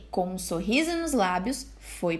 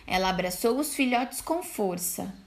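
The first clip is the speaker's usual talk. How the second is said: plain reading voice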